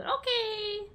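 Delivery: eager high shonen hero cry; anime boy voice. Pretend. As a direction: answer like a fired-up anime hero. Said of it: "Spoken like a true shonen protagonist".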